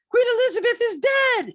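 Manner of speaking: high, loud shrieking cry; Monty Python shout. Retold or imitated. Retelling the announcement again, high loud shriek. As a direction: bellow it like a Python town crier.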